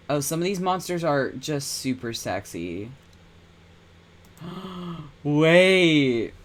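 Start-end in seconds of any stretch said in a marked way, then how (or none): 4.4-5.2 sharp excited gasp. Real, not acted. Reacting to sexy monsters. 5.2-6.3 louder, higher stunned wait; surprised. Real, not acted. Streamer spotting something exciting in the game, amazed.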